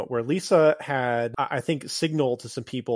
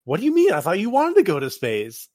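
higher, louder surprised question; Kim surprised; 6 semitones higher, 7 dB louder than usual. Retold or imitated. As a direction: squeak out her disbelief. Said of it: "And Kim's like".

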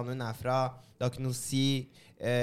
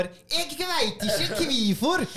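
slow, high, overly careful proper Norwegian; drawn out like a stiff textbook pupil; mock proper Norwegian kid; 10 semitones higher, 3 dB louder, much slower than usual. Mocking kids who speak proper Norwegian, slow and high. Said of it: "I stedet for".

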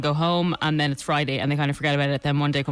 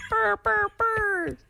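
high burr burr foghorn sound, quicker; faster foghorn; much slower than usual. Shown as a demonstration. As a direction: hoot the foghorn quicker this time. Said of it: "mine's too slow".

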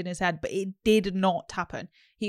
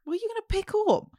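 teasing high squeak; 14 semitones higher, faster than usual. Acted. Teasing friend about trolley.

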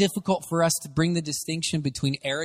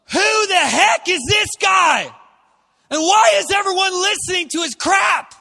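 indignant shout; 14 semitones higher, 8 dB louder than usual. Retold or imitated. Preacher acting David outraged at Goliath, indignant shout. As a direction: bellow it like young David facing Goliath. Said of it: "And he goes".

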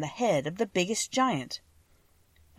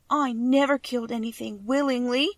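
sobbing; 5 semitones higher, 3 dB louder than usual. A narrator's reading. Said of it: "she sobbed".

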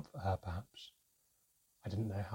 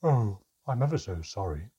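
loud, airy mock apology; dreamy; 8 dB louder than usual. A narrator's reading. Mermaid excuses her mistake, dreamy and unapologetic. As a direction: apologize dreamily without meaning it. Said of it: "she said rather dreamily, not sounding remotely apologetic".

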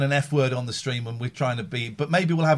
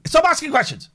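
mock exasperated yell; 13 semitones higher, 3 dB louder than usual. Real, not acted. Snapping at the screen.

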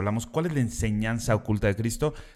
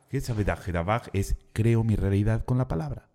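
normal talking voice

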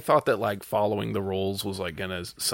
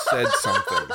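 laughing; faster than usual. Real, not acted. Laughing complaint.

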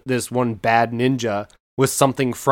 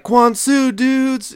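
much higher, louder cheer of the phrase; excited rallying cry; 5 dB louder, much slower than usual. Retold or imitated. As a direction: shout Quan Su high like a hyped surfer. Character shouts the rallying cry.

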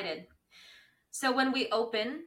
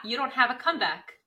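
ordinary talk

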